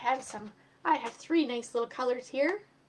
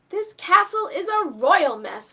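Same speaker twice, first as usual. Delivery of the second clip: slow, louder and higher, fed up; groaning complaint; 6 semitones higher, 11 dB louder, slower than usual. Acted. Fay complains to Eddie about the mess, slow groan. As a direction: drag it out, exasperated at the mess. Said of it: "Fay groaned".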